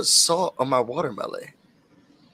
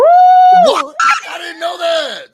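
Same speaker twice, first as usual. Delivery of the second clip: high, loud shriek of surprise; squealed, over-the-top astonishment; delighted surprise; 10 dB louder, much slower than usual. Real, not acted. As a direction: exclaim in high-pitched amazement. Reacts to crop top reveal.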